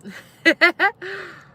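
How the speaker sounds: loud, high burst of laughter; real laugh. Real, not acted. Laughing to viewers about feeding the neighborhood kittens. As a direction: crack up at your own story.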